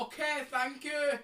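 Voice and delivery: loud, slow mock Scottish accent; hammed-up Scottish thanks. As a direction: keep up the fake Scottish accent. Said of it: "you don't need to talk like that".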